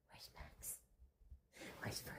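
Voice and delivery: secretive whisper to the cat. Acted. Owner whispering teasingly to her cat. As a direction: drop to a conspiratorial whisper.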